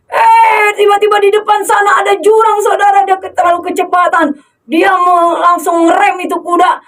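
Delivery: high, fast, alarmed telling; alarmed storytelling. Pretend. Preacher telling the rider seeing the cliff, alarmed. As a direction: rush it, danger ahead.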